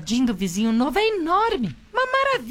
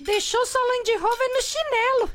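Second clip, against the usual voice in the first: lightly voiced teen son, higher; son Pimpolho Júnior; 9 semitones higher than usual. Son teasing his father, lightly higher voice. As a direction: son asking dad, a touch higher. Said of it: "não fala assim com seu pai".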